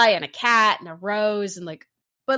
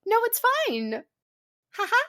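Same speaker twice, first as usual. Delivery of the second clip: giggly fairy; 13 semitones higher than usual. Retold or imitated. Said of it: "And she's like".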